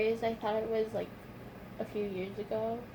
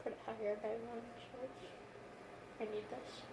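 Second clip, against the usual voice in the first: quiet; 14 dB quieter, slower than usual. Real, not acted. Patient in pain, after surgery.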